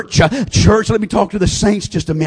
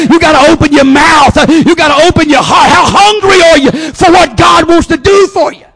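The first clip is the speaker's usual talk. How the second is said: loud, high, driving preacher cry; preaching at full force; 9 semitones higher, 10 dB louder than usual. Real, not acted. Preacher crying out to the church, loud and rising. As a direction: build the congregation to a shout.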